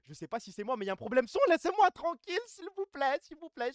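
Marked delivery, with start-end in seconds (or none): 1.5-3.7 mock pleading. Jeu de rôle suppliant, voix aiguë.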